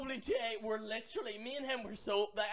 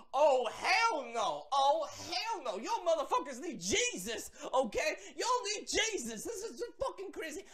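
half-laughing holy outrage; mock outraged preacher; 7 semitones higher, 5 dB louder than usual. Vlogger reacts to the fan fiction with mock preacher outrage. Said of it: "I'm like".

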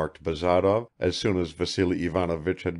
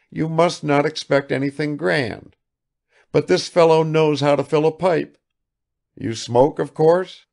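raised, eager, a little anxious to please; lightly voiced fussy father; apologetic old host; 7 semitones higher, 4 dB louder than usual. Father apologizing to the guest, eager. Vassily introducing servant.

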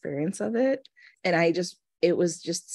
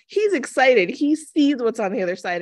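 higher, lifted voice, lightly elated; excited realisation; 6 semitones higher, 4 dB louder than usual. Retold or imitated. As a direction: retell it with a rush of wonder. Voicing her joyful realisation.

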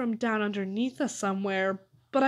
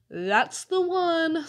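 slow, drawn-out resigned sigh; weary resignation, stretched out; 7 semitones higher, much slower than usual. A narrator's reading. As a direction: sigh it out long, starting high. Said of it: "sighed Dink".

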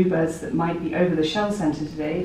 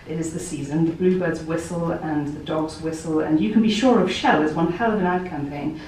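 normal talking voice

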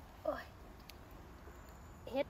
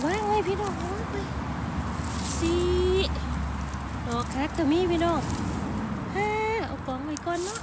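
excited; 5 semitones higher, 6 dB louder than usual. Real, not acted. Counts mushrooms while picking, excited.

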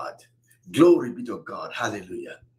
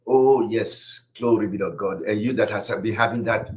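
normal talking voice

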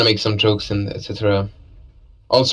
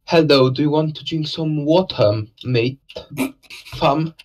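playful mock British accent, a touch higher; 4 semitones higher than usual. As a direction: ham up a British accent for your co-caster. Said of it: "can I hear your best British accent".

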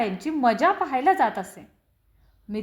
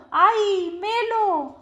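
panicked yelp pitched high; terrified cry; 8 semitones higher, much slower than usual. A narrator's reading. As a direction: cry out while falling in. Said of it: "मी ओरडलो".

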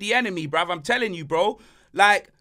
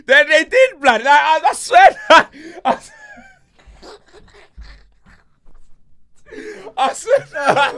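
hype shouting; 10 semitones higher, 11 dB louder than usual. Acted. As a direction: shout it out loud and high, slow and drawn. Hype shouted catchphrase opening show.

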